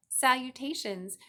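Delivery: a refined spider greeting from above; gracious spider. A narrator's reading. The voice repeats.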